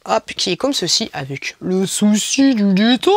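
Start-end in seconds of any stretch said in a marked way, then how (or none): 1.1-3.1 slow, drawn-out mock presentation; odd put-on voice. Presenting the Friends pouch, slow put-on affected voice. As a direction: stretch the phrase out affectedly, slowly. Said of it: "pourquoi je parle comme ça".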